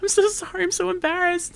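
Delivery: high, mock mortified girl voice; embarrassed apology. Fan apologizing to the mascot, high and embarrassed. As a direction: apologize all flustered. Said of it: "And she's just like".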